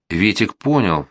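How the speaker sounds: ordinary delivery, nothing marked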